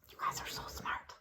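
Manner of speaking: soft secret whisper; praising the kids in a whisper. Acted. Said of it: "secretive praise to children".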